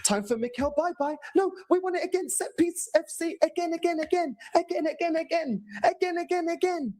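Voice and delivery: put-on high childish chant of again; mock Teletubbies. Fan mocking the team as Teletubbies, high childish chant. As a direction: chant it like a toddler on TV. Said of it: "like they're the fucking Teletubbies".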